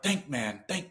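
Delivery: soft, urgent self-talk; quiet urging to himself; urgent inner voice. Retold or imitated. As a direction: tell yourself to think, under your breath. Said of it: "And I'm like".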